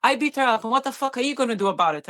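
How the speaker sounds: husband taunting the paramedic, menacing and angry; aggressive man quoted. Retold or imitated. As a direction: taunt her like the violent husband. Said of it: "he's like".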